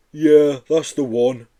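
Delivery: slow, loud, sad creature voice; a mournful monster correcting him; sad Yeti. Acted. Yeti at the window to Joe, slow and sad. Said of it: "agreed the Yeti".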